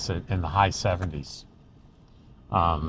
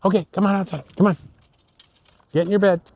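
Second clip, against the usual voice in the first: calling the dog; 9 semitones higher, much faster than usual. Real, not acted.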